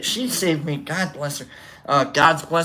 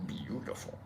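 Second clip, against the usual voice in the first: breathless whispered awe; awed whisper; 21 dB quieter than usual. Retold or imitated. Awed over the singer.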